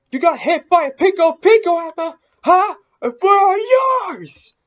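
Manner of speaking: high, loud, far above normal talk; high-pitched shouting, last words stretched out; shouted taunt. Real, not acted. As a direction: yell a triumphant challenge at the ghost. Taunting the ghost after the hit.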